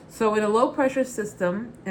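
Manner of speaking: ordinary talk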